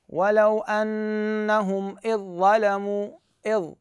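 slow chanted ولو أنهم إذ ظلموا, ذ merged into ظ; Quranic recitation. Shown as a demonstration. Teacher reciting the verse for students, slow chanted recitation. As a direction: recite it slowly, merging إذ into ظلموا. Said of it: "إيش أقول؟".

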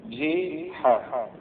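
جي حاء drawn out slowly, ji then ha; sounded-out syllables. Shown as a demonstration. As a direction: spell out the vowels in جي حاء, syllable by syllable. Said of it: "بكسر الجيم".

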